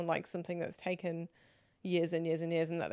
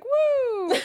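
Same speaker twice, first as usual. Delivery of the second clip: high loud woo with a laugh; wolf howl woo; 11 dB louder than usual. Shown as a demonstration. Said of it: "it's like a certain noise".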